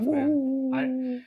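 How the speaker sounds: ordinary delivery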